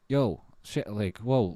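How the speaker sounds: ordinary talk, nothing marked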